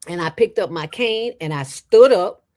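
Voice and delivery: normal talking voice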